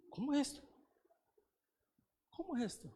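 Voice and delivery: small, high, softened voice of bewilderment; dismayed disbelief. Retold or imitated. Preacher reenacting his reply to the Lord, bewildered soft question. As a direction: ask it softly and high, as if stunned.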